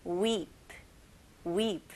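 "weep" with a long, held "ee"; long English vowel. Shown as a demonstration. Teacher has learners repeat after her, long "weep". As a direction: hold the "ee" in "weep". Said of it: "영어 발음은 좀 길게".

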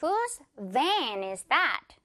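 very slow, stretched "van"; deliberate word-by-word question; slow model sentence. Shown as a demonstration. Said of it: "One more time".

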